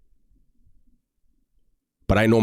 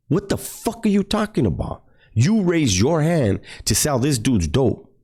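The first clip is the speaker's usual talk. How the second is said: retold angry outburst; 8 semitones higher than usual. Confronting C about backing out.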